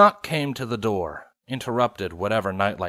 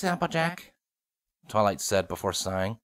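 usual speaking voice

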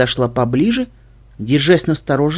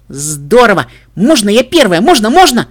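higher and louder, bubbling with joy; excited filly; 6 semitones higher, 6 dB louder than usual. Acted. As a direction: beg eagerly like a hyper filly.

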